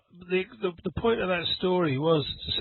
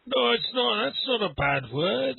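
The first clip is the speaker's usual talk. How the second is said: Glaswegian friend; 8 semitones higher than usual. Retold or imitated. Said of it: "very strong Glaswegian from Glasgow accent".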